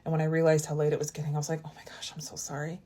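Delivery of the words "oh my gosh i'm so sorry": whispered apology. Retold or imitated. Apologizing to him for staying late.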